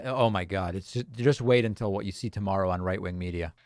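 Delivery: ordinary talk, nothing marked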